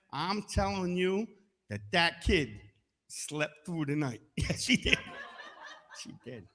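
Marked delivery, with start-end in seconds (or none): none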